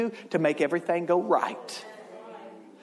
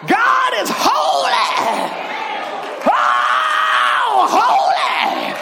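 much higher, much louder, slow ecstatic cry; Pentecostal shout; 6 dB louder, much slower than usual. Shown as a demonstration. Pastor shows the old church way, ecstatic shout. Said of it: "You gotta back up a little bit".

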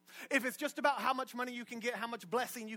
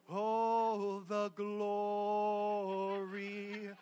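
mock rapture; 2 semitones lower, much slower than usual. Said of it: "And I'm like".

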